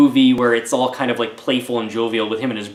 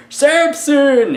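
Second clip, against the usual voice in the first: high, hammed-up yell of a name; mock dramatic challenge, pitched way up; villain calling out; 4 dB louder than usual. Reviewer mimicking the prince announcing himself to Samson, high mock call. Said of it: "the prince, who is there in person".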